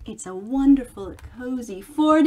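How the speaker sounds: plain reading voice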